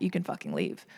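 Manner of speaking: low, soft mock boss line; quoting the boss. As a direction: drop way low to quote the boss dismissively. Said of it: "Like, verbatim".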